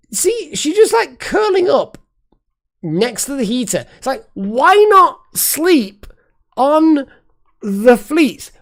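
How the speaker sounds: high, loud, slowed pet talk; fond owner calling to the cat by the heater; cooing at the cat. Real, not acted. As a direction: coo over the cat like she's adorable.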